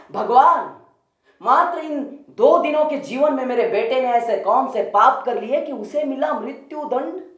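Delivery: high, pleading lament; wailing mother. Acted. Bereaved mother questions Buddha over her dead son, lamenting. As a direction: cry out to God in anguished protest. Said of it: "विलाप करती मां ने पूछा".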